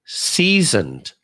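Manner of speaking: lightly voiced villain, "seasoned" drawn out slowly with a falling end; a hint of a movie villain savoring "seasoned"; Doctor Evil. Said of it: "Doctor Evil".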